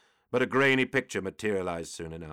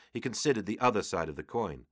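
ordinary delivery, nothing marked

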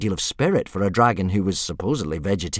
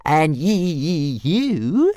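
higher, slow stutter; stammering dragon; 7 semitones higher, much slower than usual. Acted. Toothless answering Fireworm, high stutter. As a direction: stutter out the comeback coolly. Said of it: "said Toothless calmly".

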